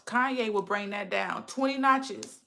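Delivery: ordinary talk, nothing marked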